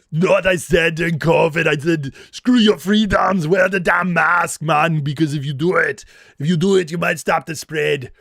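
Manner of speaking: loud, thick Austrian bodybuilder voice, hammed up; Arnold impression. Host hams up Arnold scolding covid skeptics, loud thick accent. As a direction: bark it like a movie action hero lecturing. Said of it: "I'm practically related to Arnold".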